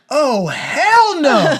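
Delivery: loud, high, long-drawn refusal; outraged refusal. Retold or imitated. Woman seeing her date's overnight bag, outraged refusal. Date refuses him staying, acted.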